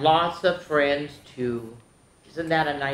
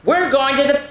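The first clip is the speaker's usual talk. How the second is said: a bit higher, cheerful call to the puppy; lightly voiced owner; 8 semitones higher, 5 dB louder than usual. Owner calling Biscuit, cheerfully a bit higher. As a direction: call the puppy along, brightly. Voicing story character.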